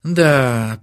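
plain reading voice

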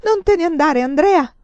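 frantic plea to Andrea; desperate pleading. A narrator's reading. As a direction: beg him not to leave. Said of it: "come incosciente".

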